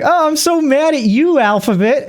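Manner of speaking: mock pouty girl. Voicing the girl's complaint.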